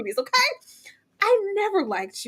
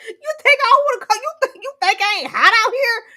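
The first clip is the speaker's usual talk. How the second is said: laughing mock sass; 7 semitones higher, 5 dB louder than usual. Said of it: "we just like".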